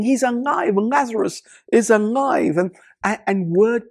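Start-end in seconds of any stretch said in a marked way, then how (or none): 0.0-2.6 high, mock-screamed joy, not very loud; half-hearted retold shout of joy; excited crowd. People spreading the news about Lazarus, mild excited shout. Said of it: "they're all screaming".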